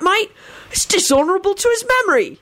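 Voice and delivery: very high, pained protest; mock-sad. A narrator's reading. Sirius shames Baldy over his friend's memory, sad. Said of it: "he said sadly".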